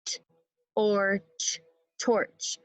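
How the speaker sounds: torch tapped as Or, ch, then torch; sound-by-sound tapping of torch; segmented sounds. Shown as a demonstration. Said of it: "One more time".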